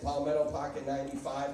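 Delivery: ordinary talk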